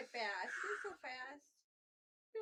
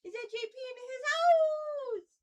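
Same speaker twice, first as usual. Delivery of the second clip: loud, high, drawn-out cheer; 11 semitones higher, 14 dB louder, much slower than usual. Real, not acted. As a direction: hype up the pet, loud and high. Hypes the dog loudly.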